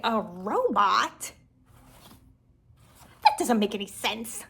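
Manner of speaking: slow, stretched mock disbelief, then protest; playfully overdone incredulous grown-up; hammed-up confusion. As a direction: ham up being utterly baffled. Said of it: "I would ham it up".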